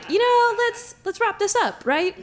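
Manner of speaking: high, sing-song let's wrap up; high wrap-up plea. Retold or imitated. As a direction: brightly hurry him along. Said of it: "I'm like".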